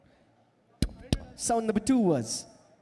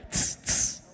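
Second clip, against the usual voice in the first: unvoiced hissing tss, hi-hat style. Shown as a demonstration. Teaching the crowd the hi-hat sound, hissed tss. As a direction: hiss through the teeth like a cymbal. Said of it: "They sound like this".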